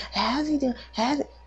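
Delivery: high-pitched, insistent retelling; imitating pestering caller. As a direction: mimic a nagging mom's repeated questions. Mimics mom who keeps asking.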